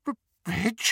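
high, stammered question; stunned. A narrator's reading. Wife sees her real husband, stunned. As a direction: falter on the name in disbelief.

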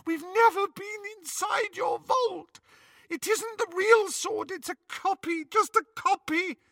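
high, tearful sobbing plea. Acted. Hermione sobs to Bellatrix under torture, high tearful plea. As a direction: sob it out in terror.

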